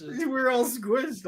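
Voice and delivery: high, drawn-out mimicked complaint; high, stretched-out mock whine; mock whining. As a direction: whine it slowly, imitating crowded girls. Voicing squished girls complaining.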